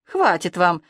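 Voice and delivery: ordinary delivery, nothing marked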